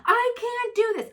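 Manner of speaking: put-on high, loud whiny little-girl voice; imitating upset daughter. Mom imitating her daughter stuck on a toy, high whiny child voice. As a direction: squeal it like a frustrated toddler. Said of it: "And she'll be like".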